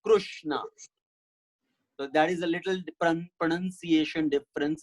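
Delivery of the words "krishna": Krishna said as Krushna, "KRUSH-na". Shown as a demonstration. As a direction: say Krushna with a u after the r. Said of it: "some so call it as Ru".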